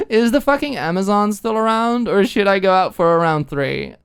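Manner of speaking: put-on loud, high, slurred drunk giggly voice; drunk Alicia. A narrator's reading. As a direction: play her tipsy and loud, laughing at herself. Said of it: "She laughed at her own joke".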